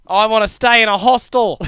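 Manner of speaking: loud, high Aussie backpacker voice; Australian accent. Acted. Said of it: "mine shifts between".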